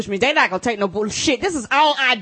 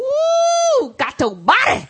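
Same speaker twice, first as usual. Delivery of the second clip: high whoop, taunting cheer; mock gleeful whoop; much slower than usual. Co-host plays the armed officer gloating after shooting, whooping. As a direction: cheer like a gamer scoring a kill.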